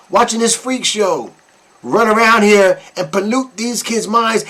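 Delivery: ordinary delivery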